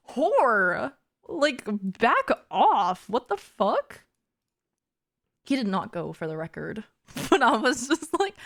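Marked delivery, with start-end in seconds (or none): 0.1-4.0 higher, hammed-up jealous snap, slow on back off; mock outrage. Telling off the girl who invited her boyfriend, hammed-up. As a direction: play the territorial girlfriend.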